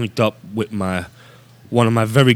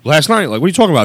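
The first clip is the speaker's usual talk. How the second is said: can't believe what he hears; incredulous; 9 semitones higher than usual. Retold or imitated. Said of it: "I'm like".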